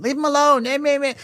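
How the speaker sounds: high, whiny mock nagging; mocking the wife. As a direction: whine it out like a scolding mother. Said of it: "The only problem is the wife".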